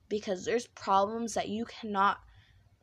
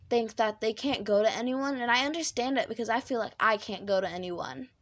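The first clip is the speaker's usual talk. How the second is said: normal talking voice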